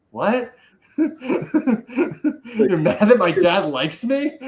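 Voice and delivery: laughing through a slow mocking question; can barely get the words out for laughing. Real, not acted.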